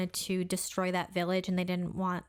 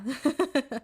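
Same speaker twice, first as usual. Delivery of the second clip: uneasy, higher-pitched laugh; awkward laugh; 5 semitones higher than usual. Real, not acted. Co-hosts on a grim topic, real awkward laugh. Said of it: "I'm laughing 'cause, like, awkward".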